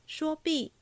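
usual speaking voice

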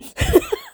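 very high embarrassed squeal; squealing laugh. Real, not acted. Host laughs at finally daring to comment, high squeal. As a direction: let out a bashful shriek of laughter. Laughs at her late courage.

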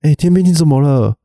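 high, startled calling out; surprised call out. Retold or imitated. A trainee notices the soldier crying, startled calling out. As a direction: call across, shocked at the tears. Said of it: "就有人突然说".

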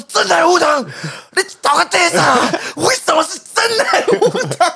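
high, loud retold rant; mock-furious complaint, half laughing; angry outburst. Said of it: "我那天爆气".

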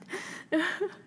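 尖声笑出来; 对自己的胡扯笑出高音; 高音大笑. Real, not acted. 说自己是香港人后大笑. (高亢的自嘲笑声)

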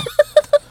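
short high burst of laughter; sharp laugh. Real, not acted. Laughs at survey comment.

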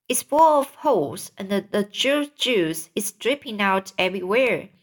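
usual speaking voice

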